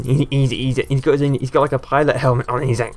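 ordinary talk

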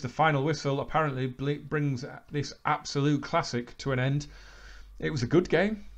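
usual speaking voice

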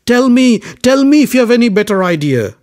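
high, loud desperate pleading. A narrator's reading. Satyavati desperately begs Bhishma, high and loud. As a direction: beg him for any answer. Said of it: "Satyavati was desperate".

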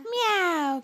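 loud playful meow; cat meow. Pretend. Imitating cat for baby.

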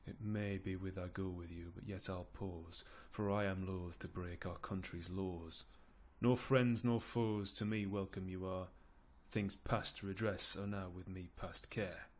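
soft, subdued verse delivery; weary Duke of York. Acted. York is loath to break the laws, wearily quiet. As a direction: waver quietly, resigned to it.

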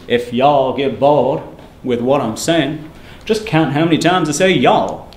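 slow, drawling southern accent imitation; imitating an old economics professor's southern accent. Lecturer mimics his deadpan economics professor in a slow southern accent. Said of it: "he had a strong southern accent".

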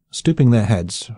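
usual speaking voice

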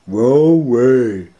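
high, loud Scooby refusal; Scooby-Doo voice. Acted. Scooby refuses to go outside, high loud dog voice. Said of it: "Scooby shook his head".

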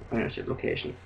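muttered under his breath in a whisper; whispered aside. Real, not acted. Player correcting the tutorial wording, whispered aside. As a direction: slip it in quietly.

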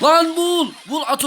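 high, loud bark at a teammate; real gamer yell; frustrated yell. Real, not acted. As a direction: shout him into action. Yelling at teammate in game.

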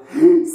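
sharp high gasp; shocked gasp. Pretend. Pretend pupil shocked by paper use.

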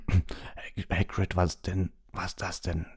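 spluttered words after a cough. A narrator's reading. Ron hit by a terrible smell, coughing. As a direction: clear your throat and choke out the question. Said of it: "hüstelte Ron".

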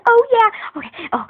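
usual speaking voice

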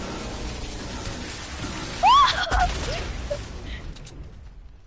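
high squealing laugh; high shrieking laugh. Real, not acted. Big laugh after fight.

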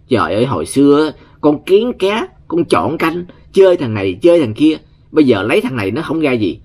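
hammed-up father chiding his picky daughter; scolding father.

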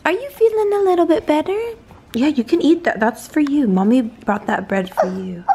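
high, loud cheerful coaxing. Real, not acted. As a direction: cheer her into eating. Mother coaxing baby to eat.

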